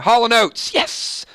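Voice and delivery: high cheer then a breathy yes; real little cheer of triumph; excited cheer. Real, not acted. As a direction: celebrate the win. Host cheers his pick winning.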